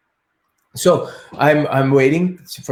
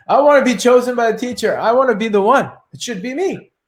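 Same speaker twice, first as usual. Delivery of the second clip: high, quick, demanding whine of the ego; pushy self-important insistence, pitched up; insistent ego; 7 semitones higher, 3 dB louder than usual. Retold or imitated. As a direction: plead loudly to be the chosen one. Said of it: "My ego was like".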